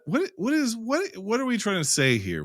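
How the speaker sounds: high-pitched, bewildered self-questioning; exasperated questioning. Retold or imitated. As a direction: stammer it out, high and incredulous. Voices his own bafflement at film.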